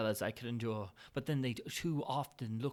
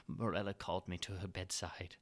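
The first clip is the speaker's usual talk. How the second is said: ordinary delivery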